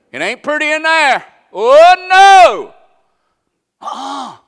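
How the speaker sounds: high, loud cry of oh no; preaching shout. Real, not acted. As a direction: swell up and shout it down to the pews. Preacher on funeral home backroom.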